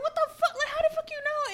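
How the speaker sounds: high-pitched, incredulous reenactment; mock-shocked squeal with a laugh; retold bewilderment. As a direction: act out baffled disbelief, voice way up. Reacting to stranger knowing her name.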